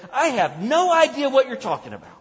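high, loud flat-out denial; emphatic denial. Acted. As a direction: shove the question away hard. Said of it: "Peter said".

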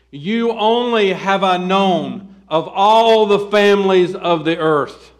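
loud, slow, drawn-out proclamation; forceful scripture declamation. Acted. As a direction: proclaim it as God's own word. Declaims God's words from scripture.

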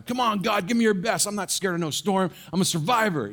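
mock-brave boy yelling at God; defiant kid. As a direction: challenge the storm like a brave kid. Kid defies storm in street.

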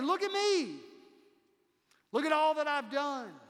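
high, loud, puffed-up royal boast; boasting king. Retold or imitated. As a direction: strut it like a proud tyrant admiring himself. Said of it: "He's saying".

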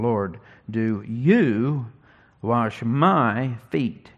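slow read, leaning on "you" and "my"; deliberate slow stress on "you" and "my"; stress on the pronouns. Shown as a demonstration. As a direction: press "you" and "my" in "Lord, do you wash my feet?". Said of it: "I'll put some emphasis on the personal pronouns".

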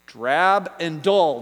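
Drab and dull spelled out slowly; slow relieved emphasis; slow, emphatic. Real, not acted. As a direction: land each word of Drab and dull. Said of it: "There you go".

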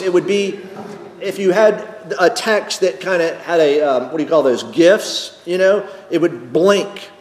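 ordinary delivery

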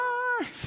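a quick high burst of laughter. Real, not acted. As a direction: laugh at your own surprise yell. Laugh after surprise shout.

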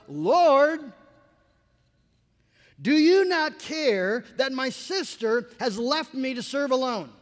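loud, slow, indignant plea; Martha's outburst. Retold or imitated. Martha complaining to Jesus about her sister, loud and indignant. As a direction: burst out at the Lord, aggrieved.

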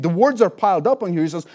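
usual speaking voice

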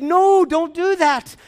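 high, loud, drawn-out plea; afraid. Retold or imitated. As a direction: cry out in alarm, begging them to stop. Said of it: "he was so afraid and he said".

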